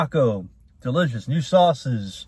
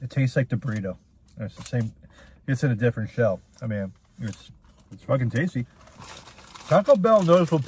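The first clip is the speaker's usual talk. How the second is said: speaking between bites; chewing while talking. Real, not acted. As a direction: mouth partly full of food. Seen 1: Bit taco at -5s; jaw moving, food in hand throughout.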